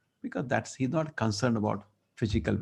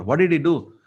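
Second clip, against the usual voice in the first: higher, louder, pressing; indignant demand; 6 semitones higher, 6 dB louder than usual. Acted. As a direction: demand the facts at once. Son demanding details.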